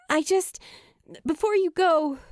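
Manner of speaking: high, rushed and pleading; eager, breathless; urgent. A narrator's reading. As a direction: catch him before he goes. Julie hurriedly stopping Luke.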